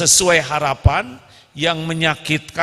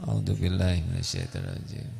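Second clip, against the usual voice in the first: soft, fast low mumble; mock mumbled prayer; 7 semitones lower, 10 dB quieter, faster than usual. Wife praying softly instead of answering, fast mumble. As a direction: mutter the prayer under the breath. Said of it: "mumbled prayer".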